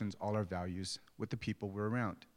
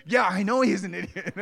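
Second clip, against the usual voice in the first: high, loud retold outburst; hammed-up shouting dad; mock exasperated yell; 9 semitones higher, 11 dB louder than usual. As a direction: burst out in comic agreement. Dad heatedly agrees with son.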